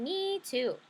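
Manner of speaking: mild little-sister voice. Retold or imitated. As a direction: pipe it up like a small girl. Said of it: "Who do you think is saying".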